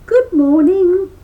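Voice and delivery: perky critter calling good morning; cheery rabbit greeting. A narrator's reading. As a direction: squeak out a big friendly hello. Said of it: "he greeted the tar baby".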